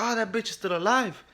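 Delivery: high-pitched exclamation of alarm; mock startled cry; retold startled reaction. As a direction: relive the jump scare, voice shooting up. Acts own jump-scare reaction.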